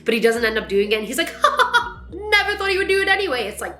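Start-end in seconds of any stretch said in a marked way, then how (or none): 1.4-2.1 mock laugh. Thor's retold dismissive laugh. 2.1-3.4 high, fast, laughing mock-casual brush-off; mock Thor. Mocking Thor after Captain America nearly lifts the hammer, laughing.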